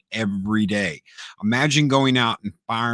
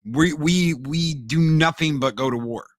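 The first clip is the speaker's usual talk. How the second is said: lightly acted rebuke, raised pitch; Leonidas scolding; 4 semitones higher than usual. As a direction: scold the amateurs like a proud warrior, lightly played. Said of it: "Leonidas scolds them and he's like".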